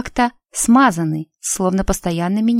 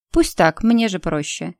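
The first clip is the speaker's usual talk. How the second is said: plain reading voice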